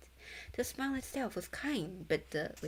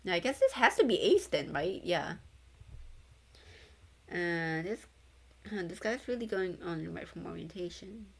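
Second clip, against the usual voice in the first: ordinary delivery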